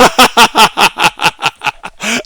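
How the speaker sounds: hearty laugh; laughing out loud; amused cackle. Real, not acted. Laughs after catchphrase.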